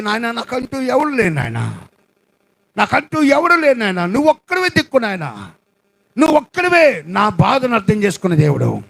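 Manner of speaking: urgent preached plea to God; pleading prayer. Acted. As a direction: beg God, rising to a cry. Voiced prayer of believer. (దేవుని వేడుకునే ప్రార్థన)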